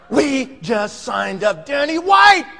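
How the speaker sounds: slow, high, building to a shout; triumphant announcement. Retold or imitated. Husband announcing new signing to wife, triumphant shout. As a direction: stretch out the big news triumphantly.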